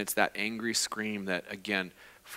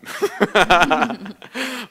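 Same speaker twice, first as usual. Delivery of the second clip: loud laugh; 8 semitones higher, 10 dB louder than usual. Real, not acted. Laughs at own joke.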